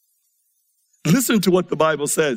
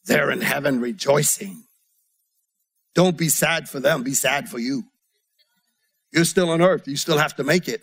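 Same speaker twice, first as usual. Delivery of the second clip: voice of the Holy Spirit; 4 semitones lower than usual. Retold or imitated. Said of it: "the Holy Spirit says".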